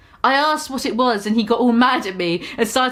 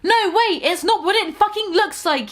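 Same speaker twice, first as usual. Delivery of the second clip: high, pleading mock yell; mock shout; 9 semitones higher than usual. Boyfriend shouting at girlfriend, high mock yell. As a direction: cry out high to stop her. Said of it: "he shouted".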